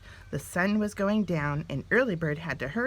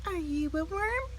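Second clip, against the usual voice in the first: small bird voice; 11 semitones higher than usual. A narrator's reading. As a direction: ask like a tiny curious chick. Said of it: "asked Early Bird".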